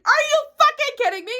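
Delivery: loud, high-pitched outraged question; incredulous, furious yell; angry disbelief. Retold or imitated. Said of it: "Me getting angry".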